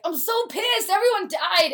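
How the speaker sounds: high whiny complaint; annoyed audience. Retold or imitated. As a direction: whine like a sulky audience member.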